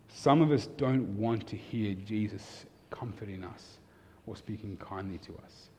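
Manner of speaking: ordinary talk, nothing marked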